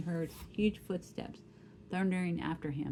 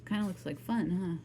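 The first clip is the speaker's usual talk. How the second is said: ordinary delivery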